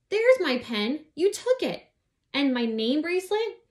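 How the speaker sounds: high, loud accusation; accusing. Acted. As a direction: throw the blame at her. Abby accuses Miranda.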